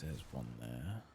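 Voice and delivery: ordinary talk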